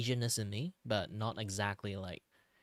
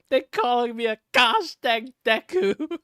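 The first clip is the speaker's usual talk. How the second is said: much higher and louder, slow and drawn out; mock outrage; 12 semitones higher, 12 dB louder than usual. Streamer complaining to chat about the Deku nickname, fake outrage. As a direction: wail it in fake despair.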